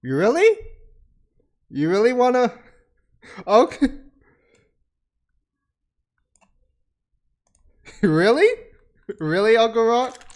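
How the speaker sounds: loud, high, teasing questions; mocking disbelief. Real, not acted. Talking to Uncle Rock on screen, loud teasing disbelief. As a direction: needle him like you can't believe his nerve.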